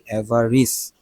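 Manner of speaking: অ্যাভারিস with a short "ris" ending, falling; correct pronunciation. Shown as a demonstration. Teacher giving the corrected অ্যাভারিস to students, falling. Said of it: "কিন্তু এটা কি?".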